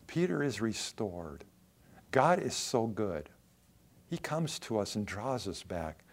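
ordinary talk

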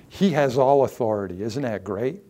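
ordinary talk, nothing marked

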